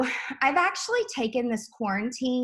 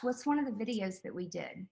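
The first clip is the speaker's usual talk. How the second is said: ordinary talk, nothing marked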